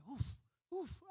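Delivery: put-on baby woof. Baby imitates a dog.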